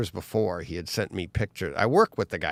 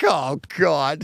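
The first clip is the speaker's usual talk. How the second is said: dismay; 6 semitones higher, 5 dB louder than usual. Retold or imitated. Reacting to crushed bike photo.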